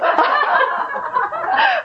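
high delighted laughter; real high laugh. Real, not acted. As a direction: burst out laughing. Laughter after her reply.

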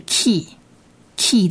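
key，key said softly and slowly; soft word demo. Shown as a demonstration. Teacher sounds out the syllable for students. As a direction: breathe out key，key gently and slowly. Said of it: "加上短母音e是".

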